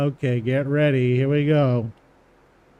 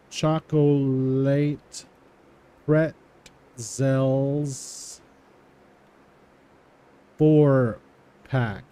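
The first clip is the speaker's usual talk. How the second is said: slow dictation; much slower than usual. Shown as a demonstration. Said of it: "typing slowly after reading it".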